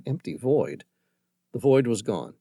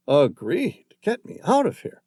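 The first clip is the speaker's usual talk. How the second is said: eager; 9 semitones higher, 4 dB louder than usual. Acted. Hilton eager to flee.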